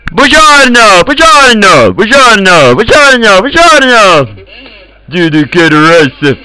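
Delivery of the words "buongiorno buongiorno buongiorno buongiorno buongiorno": high, swooping Italian greeting; exuberant calling out. Real, not acted. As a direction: sing out each greeting high, letting it fall. Calls Italian greetings over and over. (exuberant high-pitched call)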